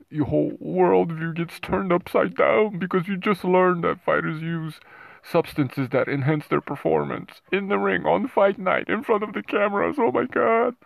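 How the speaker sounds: high, loud hammed-up dismay; mock outrage. Host mocking viewers whose worldview gets turned upside down, mock outrage.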